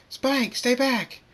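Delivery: high, loud, drawn-out warning; urgent warning shout. Acted. Twilight warns Spike back from danger, urgent high shout. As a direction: cry out to protect someone.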